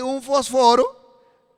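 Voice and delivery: "fósforo" stressed as fosFÓro; Greek pronunciation. Shown as a demonstration. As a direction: say "fósforo" with the second o accented, rising. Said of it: "estoy hablando en griego". ("fósforo" a la griega, con acento en la segunda o)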